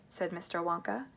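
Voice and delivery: ordinary delivery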